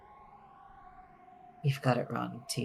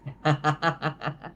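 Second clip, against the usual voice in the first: haughty witch laugh; 4 semitones higher, 6 dB louder than usual. Acted.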